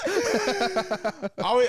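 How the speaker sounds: high, loud, stretched out by laughing; through laughter. Real, not acted. Getting back to the point with co-host, still laughing. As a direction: try to talk while cracking up.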